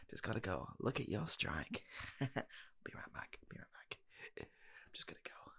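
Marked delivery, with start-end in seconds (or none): 2.2-5.5 soft hushed whisper, slipping away; whispered exit. Acted. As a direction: whisper it as you sneak out. Sneaking off whispering.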